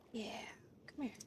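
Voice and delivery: unvoiced taunting whisper; coaxing whisper. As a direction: whisper to lure the shark closer. Taunting the shark.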